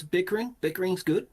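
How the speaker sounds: high, lively voice with rising question; chuckling pleasure, pitched well up; bright-eyed relish in a high character voice; amused character. Acted. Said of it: "Uba kinda chuckles and goes".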